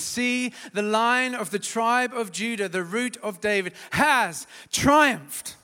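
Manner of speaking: loud, high declaration; triumphant proclamation. Acted. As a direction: announce the victory of the Lion of Judah. Reading Revelation as a proclamation.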